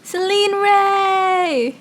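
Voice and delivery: high, drawn-out announcer-style introduction; guest reveal. Real, not acted. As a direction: stretch the name out like a big reveal.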